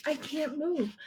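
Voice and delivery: ordinary delivery, nothing marked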